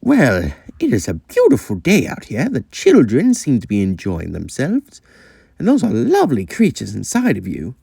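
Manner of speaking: lightly voiced friendly old engine; a hint of an old engine chatting; casual old engine. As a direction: make polite small talk in character. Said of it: "casually talking with Trevor".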